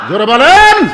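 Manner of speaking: loud, high call to the crowd; shouted prompt. Real, not acted. Preacher demands louder crowd response. (চিৎকার করে জোরে বলতে বলা)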